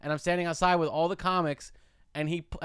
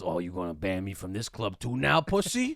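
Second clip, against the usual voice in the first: mock the guy who got you banned; Knife Guy sneering at the comic who blocked him; taunting tough guy; 7 semitones lower than usual.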